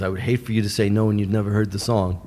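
ordinary talk, nothing marked

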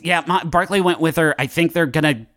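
flustered run-on phone report; Jake Cool. Acted. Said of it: "And he says".

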